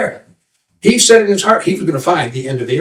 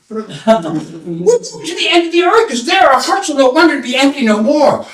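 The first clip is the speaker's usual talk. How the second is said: high, drawn-out proclamation; calling out; 10 semitones higher than usual. Acted. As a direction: relay the grand answer in a high voice. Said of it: "they're calling something".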